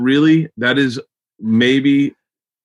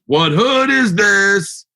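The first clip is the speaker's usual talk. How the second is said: slow, higher, put-on street commentator; imitating the fight commentator's opener, slow and higher; Crime Faces impression; 7 semitones higher, much slower than usual. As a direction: drag it out like the Instagram guy. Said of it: "every video he starts is".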